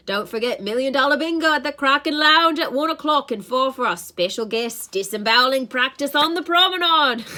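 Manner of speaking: loud, high Australian cruise announcer; too-happy Australian accent. A narrator's reading. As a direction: announce brightly in a badly faked Australian accent. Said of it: "with an Australian accent who sounded way too happy".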